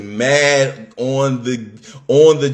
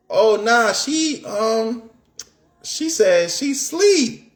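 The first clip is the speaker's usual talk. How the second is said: friend's reply mimicked, pitched up and slow at the end; high girl voice; 10 semitones higher, slower than usual. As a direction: play the friend high and drawn out.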